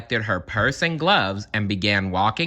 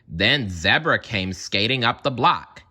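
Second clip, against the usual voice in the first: usual speaking voice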